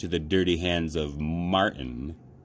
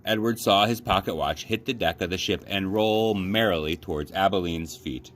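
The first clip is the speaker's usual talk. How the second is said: plain reading voice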